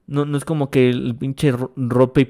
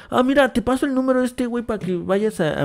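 casual gossip voice; 10 semitones higher, faster than usual. Retold or imitated. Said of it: "como si fuera".

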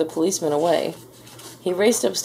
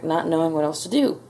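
plain reading voice

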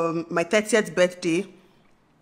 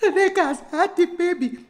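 high, slow, laughing words; laughing announcement; 9 semitones higher, slower than usual. Retold or imitated. Telling her husband the news, a high laughing announcement. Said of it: "I'm like".

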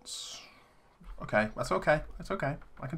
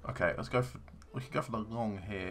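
usual speaking voice

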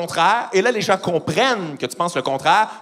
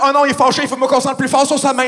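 high, raised anxious voice; worried dog; 8 semitones higher, 4 dB louder than usual. Acted. Dog seeing its owner is angry, anxious caricature. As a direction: think aloud as an alarmed pet, hammed up.

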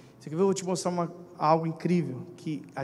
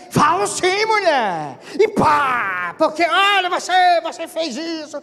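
high, loud angry retort; furious husband snapping back; husband yelling back; 11 semitones higher, 8 dB louder, slower than usual. Acted. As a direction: shout the wife down.

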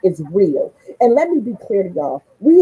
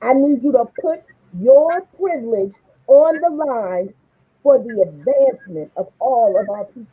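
usual speaking voice

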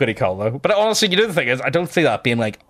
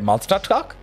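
put-on toddler's German accent; 6 semitones higher than usual. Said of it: "this weird German accent".

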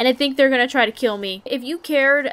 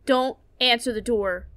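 usual speaking voice

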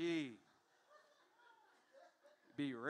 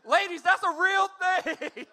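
much louder, slow, laughing through it; laughing shout; 6 semitones higher, 11 dB louder, slower than usual. Real, not acted. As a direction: shout it to the ladies while cracking up.

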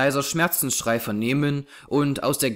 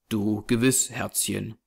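softened, lower, gentle reply; tender man; 4 semitones lower, 3 dB quieter than usual. A narrator's reading. Man to the doomed child, tender and subdued. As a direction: trail softly into the endearment.

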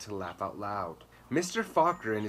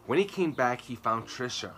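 usual speaking voice

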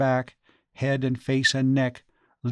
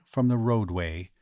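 ordinary delivery

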